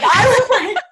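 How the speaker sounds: breaking into a high, loud laugh. Real, not acted. Telling her friend the story, laughing hard.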